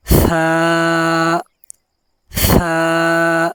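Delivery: "ثا" with the tongue tip between the teeth. Shown as a demonstration. Teacher demonstrating to students: "ثا" tongue out. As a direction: push the tongue out a little for "ثا". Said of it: "زبان باہر نکال کر پھر آواز نکالی جائے گی".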